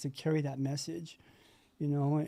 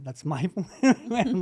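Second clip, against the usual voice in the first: slow, louder, higher, laughing through the words; laughing at himself; 4 semitones higher, 5 dB louder, much slower than usual. Real, not acted. Sharing with the meeting, he laughs at his plan. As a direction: laugh at your own doomed plan, stretching it out. Ironic repeat of failed plan.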